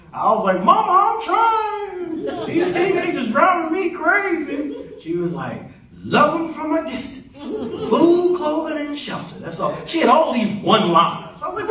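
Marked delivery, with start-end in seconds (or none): 0.6-4.7 hammed-up frazzled wail; exasperated complaint. Retold complaint to mother about teenagers. 6.0-9.4 high-pitched imitation of mother; comic mother voice, pitched up; mother's one-liner.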